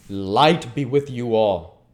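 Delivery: ordinary delivery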